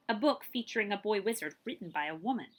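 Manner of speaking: plain reading voice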